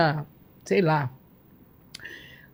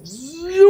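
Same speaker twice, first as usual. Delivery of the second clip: whoosh sound; 8 semitones higher, 5 dB louder than usual. Retold or imitated. Imitates flying speed.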